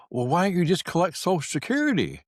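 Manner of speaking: mimicking nosy neighbor. Neighbor quizzing the dog walker.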